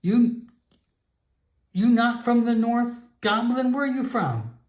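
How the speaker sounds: high, broken-English grandmother voice; stern old shaman warning off a stranger; old frog shaman. Acted. Grandmother shaman warns the Nightkin away, high and stern.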